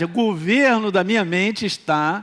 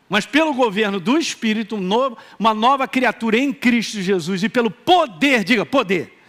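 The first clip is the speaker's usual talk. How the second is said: normal talking voice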